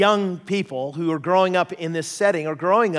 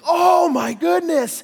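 high, slow, louder wonder; awed exclaim; 12 semitones higher, 7 dB louder, slower than usual. Retold or imitated. Ontario pastor first seeing bears, high awed exclaim. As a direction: marvel like a newcomer seeing bears. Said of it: "he's like".

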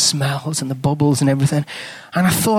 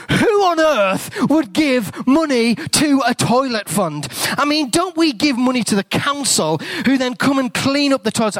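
outraged disbelief; 7 semitones higher than usual. Retold or imitated. Dad scoffing at the toilet donations box, high and incredulous. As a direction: stretch out the indignation.

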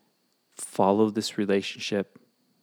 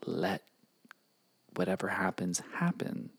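whispering; 4 semitones lower, 6 dB quieter than usual. Real, not acted. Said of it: "Okay, it's a secret".

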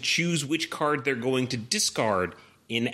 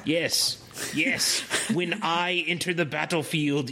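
cartoon villain voice; 5 semitones higher, slower than usual. Continues villain voice.

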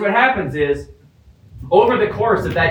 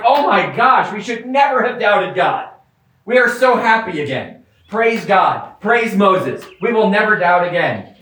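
raised, gushing praise; overjoyed Israelites; 4 semitones higher than usual. Retold or imitated. Preacher voicing Israelites after the Red Sea, gushing praise. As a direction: gush with relief after the sea parts. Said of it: "they go through the Red Sea and like".

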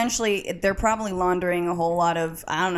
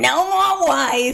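slow, drawn-out mock mobster voice; Trump impression; 5 semitones higher, 3 dB louder, much slower than usual. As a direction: stretch each word like a tough guy. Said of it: "it sounded like John Gotti".

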